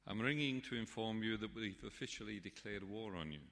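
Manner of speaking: a light Irish brogue, cheerfully declaring war; mild Irish publican voice; Irish accent. Retold or imitated. Paddy from the Sligo pub declaring war on the dictator, light Irish accent. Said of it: "this heavily accented voice said".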